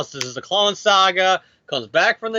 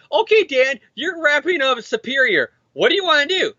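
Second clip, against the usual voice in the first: mock editor; 7 semitones higher than usual. Editor voiced in mock dialogue.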